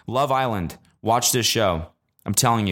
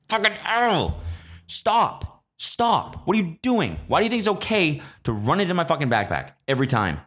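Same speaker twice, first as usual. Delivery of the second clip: exasperated rant; 8 semitones higher than usual. Retold or imitated.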